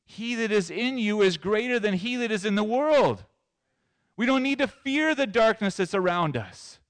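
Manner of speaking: high, ringing declaration; impassioned preaching. Real, not acted. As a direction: proclaim it to rouse the congregation. Preacher rises to full force.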